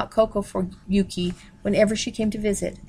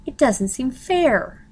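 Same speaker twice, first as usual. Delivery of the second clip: objecting; 5 semitones higher, much slower than usual. A narrator's reading. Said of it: "she objected to Ken".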